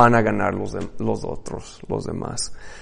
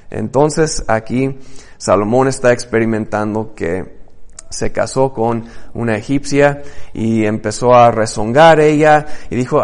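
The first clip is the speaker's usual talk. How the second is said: usual speaking voice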